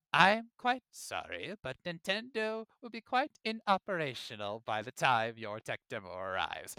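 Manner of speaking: slow, menacing evil overlord; slow sinister villain. Pretend. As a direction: drawl it out like a calm supervillain.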